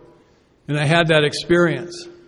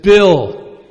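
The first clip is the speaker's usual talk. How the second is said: sponsor's blunt retort; 6 dB louder, much slower than usual. Retold or imitated. Sponsor quoted.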